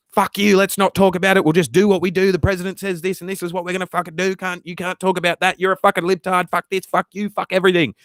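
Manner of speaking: high-pitched hammed-up political rant; mock angry right-winger. Streamer imitating right-wingers refusing to discuss immigration, mock angry rant. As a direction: rattle off abuse as a mock outraged partisan. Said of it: "some people on the right will be like".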